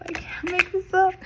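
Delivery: jumps way up in pitch, squealing in amazement; high squeal of disbelief. Real, not acted. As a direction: squeal it out, unable to believe it. Stunned by the card reading.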